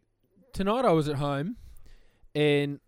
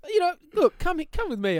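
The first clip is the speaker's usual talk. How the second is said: eager neighbour; 11 semitones higher than usual. Retold or imitated. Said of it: "And he goes".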